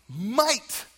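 "might" punched high and loud; stress on might. Shown as a demonstration. Preacher joking about spilled ice cream, stress on "might". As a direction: lean hard on "might" to show doubt. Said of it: "Emphasis on the might".

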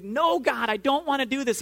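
put-on high-pitched, childish protest, no real shouting; mock screaming protest. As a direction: act out a mild tantrum against God. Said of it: "we scream against him".

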